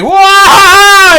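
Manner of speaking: very high loud shouted whoa; startled cry. Retold or imitated. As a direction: yelp as he trips. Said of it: "he's like".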